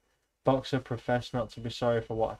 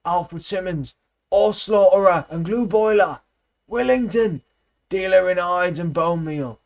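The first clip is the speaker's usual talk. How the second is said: grim furious reading; 8 semitones higher, 8 dB louder than usual. A narrator's reading. Benjamin reading the van's lettering aloud, grim and loud. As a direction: read it out like an accusation. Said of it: "in the midst of a deadly silence he read".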